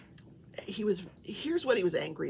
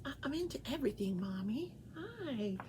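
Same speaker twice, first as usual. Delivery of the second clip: voicing the pet; 3 semitones higher, 6 dB quieter than usual.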